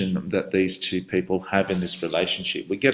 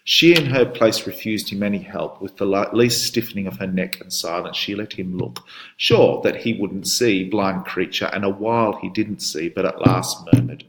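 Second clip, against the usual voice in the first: reading the husband's demands aloud, a bit louder; dramatic poem reading; 4 dB louder than usual. Shown as a demonstration. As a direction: read the poem with force, as a threatening husband. Teacher reads poem narration aloud.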